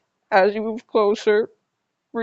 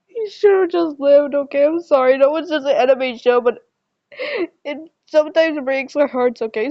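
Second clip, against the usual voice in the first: higher, tearful protest; real crying; 4 semitones higher, 3 dB louder than usual. Real, not acted. Fan who can't handle the character's pain, crying. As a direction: plead through tears, voice rising. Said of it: "I'm sorry I'm crying".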